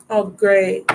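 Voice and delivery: usual speaking voice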